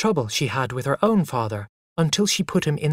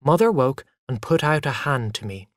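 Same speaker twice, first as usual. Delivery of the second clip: ordinary delivery, nothing marked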